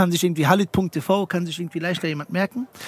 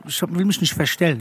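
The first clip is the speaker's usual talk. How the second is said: ordinary talk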